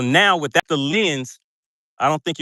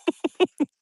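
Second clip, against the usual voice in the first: high laugh; 14 semitones higher than usual. Real, not acted. Laughs at chat.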